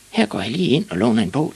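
high, lightly voiced story kid; little boy Ivan. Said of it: "tænkte han".

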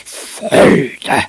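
loud, high, rough outburst; explosive drawn-out cry; outraged scream. A narrator's reading. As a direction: scream it in disgust as an outraged father. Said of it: "skreg hans".

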